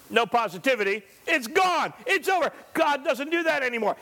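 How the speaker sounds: ordinary talk, nothing marked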